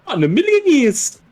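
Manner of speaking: high, loud mimicked outburst; acted defiant quote. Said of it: "and he goes".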